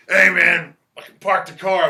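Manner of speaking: put-on loud, high, pushy drunk shout; mock drunk hollering at the friend driving him home; drunk Ron yelling. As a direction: bellow it like a buzzed rocker who won't be told no. Drunk Ron insists on parking.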